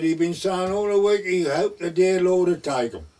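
high, loud voice of the farmer's wife; loud retelling. Retold or imitated. Farmer's wife telling the vicar about her husband, loud and high. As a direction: tell the vicar loudly over the bed.

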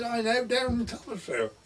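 high, put-on voice of the bishop, a touch imitating; retold bishop's voice. Bishop asking the vicar about his old shipmate, high imitating voice.

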